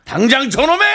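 high, loud period-drama order; hammed-up royal-court shout; historical drama command. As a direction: bellow it like a sageuk official. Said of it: "옛날 사극에서".

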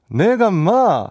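stretched, very slow clumsy Gyeongsang "내가 마"; awkward fake Gyeongsang dialect. DJ showing why locals told him to stop, slow clumsy Gyeongsang accent. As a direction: drag out "내가 마" in a forced Gyeongsang accent. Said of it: "이런 느낌이 되니까 이게 오히려 더 웃음거리가".

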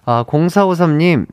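ordinary talk, nothing marked

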